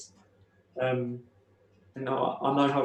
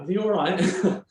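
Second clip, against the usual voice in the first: higher, louder, drawn-out mock query; bemused onlooker; 6 semitones higher, 5 dB louder than usual. Onlookers wondering about the shouting driver, bemused. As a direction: imitate a puzzled passer-by, pitched up and slow. Imagined onlookers at his meltdown.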